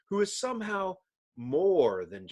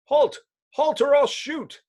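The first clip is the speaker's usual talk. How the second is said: loud, higher barked warning; nervous cop; 10 semitones higher, 4 dB louder than usual. Acted. Policeman confronting the Thing, loud and tense. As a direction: shout a jittery command.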